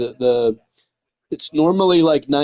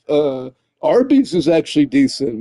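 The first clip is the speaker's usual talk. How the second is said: ordinary delivery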